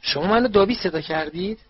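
a high curious squeak. A narrator's reading. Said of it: "هری با کنجکاوی جیغ زد".

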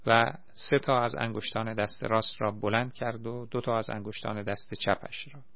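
usual speaking voice

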